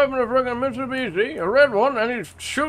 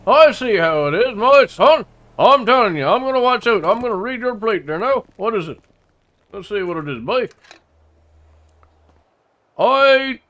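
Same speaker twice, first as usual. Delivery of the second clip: put-on thick rural accent; 3 dB louder than usual. Local insists this is how he always talks, thick rural accent. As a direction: lean hard on the rural accent and the boy.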